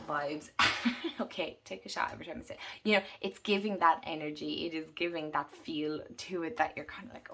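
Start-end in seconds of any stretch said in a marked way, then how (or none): none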